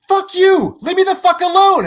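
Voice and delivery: high, loud, hammed-up outburst; angry movie quote. Quoting a movie character who then hangs up, angry shout. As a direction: yell it like telling off a caller. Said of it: "It goes".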